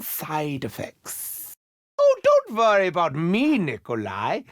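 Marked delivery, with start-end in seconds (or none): none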